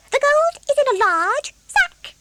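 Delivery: two little fish piping up. A narrator's reading. Two fish speak to David.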